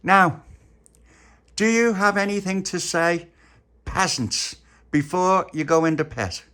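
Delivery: put-on high, loud regal child queen; bossy queen. Queen sentencing her prisoners, haughty and loud. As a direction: lord it over the peasants.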